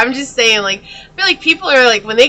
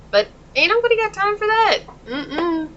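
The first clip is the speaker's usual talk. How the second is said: high sassy meme voice, brushing it off; sassy dismissal; 10 semitones higher, 3 dB quieter than usual. Pretend. Tarot reader dismissing hangers-on, sassy and high. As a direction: toss it off with big attitude, pitched way up.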